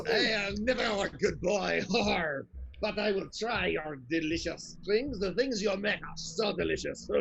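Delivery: lightly voiced French-accented ogre; a hint of a goofy French pirate ogre; half-hearted French ogre accent. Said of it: "combine my ogre voice with a French accent with pirate".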